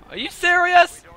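high, loud disbelief; incredulous outburst. Real, not acted. Player protesting at the game, incredulous outburst.

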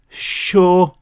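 mildly quavering, unsure; tremor in the voice. A narrator's reading. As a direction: agree nervously, voice trembling. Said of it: "his voice a tremor".

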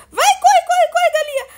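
high, loud urgent urging; urgent cheering. Real, not acted. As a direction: cheer it on in a rush. Urging chicken to run fast.

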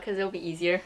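normal talking voice

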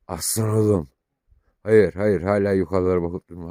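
loud firm no to a pet; firm call to the dog. Real, not acted. Owner talking firmly to his dog.

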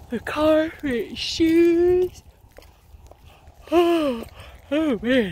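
high, loud, stretched-out yawn through the words. Real, not acted. Vlogger explaining her errand while yawning widely. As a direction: talk right through a big drawn-out yawn. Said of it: "Yawning away".

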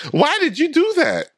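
high-pitched shocked question; incredulous outcry. Real, not acted. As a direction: demand it in a high, baffled yelp. Reacting to touched electric fence.

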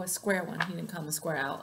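ordinary delivery, nothing marked

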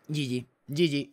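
announcer voice. Acted. Victory announcement after the battle.

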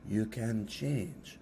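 very slow, hushed guru voice; Eckhart Tolle. Retold or imitated. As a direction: draw it out softly, like a serene spiritual teacher. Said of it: "And then I found Eckhart Tolle. And he was like".

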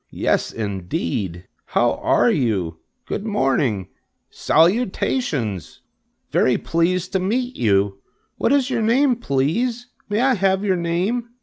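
high-pitched pig, gushing politely; eager little piggy voice; Wilbur. A narrator's reading. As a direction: greet a new friend in high, drawn-out delight.

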